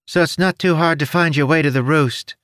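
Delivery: high, odd little creature voice; chirpy winged creature, helpful. Acted.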